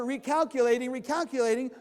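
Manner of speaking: loud repeated robotic nagging; GPS voice. Retold or imitated. Mimicking GPS for a lost driver, loud nagging robotic. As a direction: mimic the nagging navigator again. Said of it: "imagine now the person on that path".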